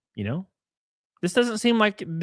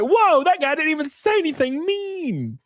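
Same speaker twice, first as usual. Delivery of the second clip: mock surprise; 13 semitones higher than usual. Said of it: "I'm like".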